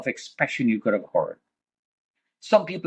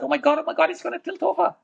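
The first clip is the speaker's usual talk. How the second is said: much higher, frantic retold cry; retold panic; faster than usual. Watching the tower tilt, a frantic high cry of fear. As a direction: relive the terror as it sways.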